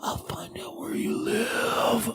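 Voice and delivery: menacing whisper. Shown as a demonstration. Whispered threat inside promo.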